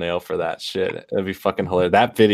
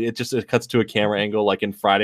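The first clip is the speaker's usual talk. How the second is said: ordinary delivery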